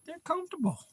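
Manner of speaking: very high little cooing voice; pet-doting voice. Real, not acted. Owner doting on pups on his lap, high pet voice. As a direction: coo high over the pups.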